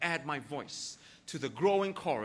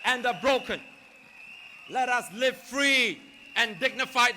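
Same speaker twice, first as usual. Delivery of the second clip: shouted rally climax, higher and louder; urgent activist; 5 semitones higher, 6 dB louder than usual. Acted. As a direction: roar it to the crowd.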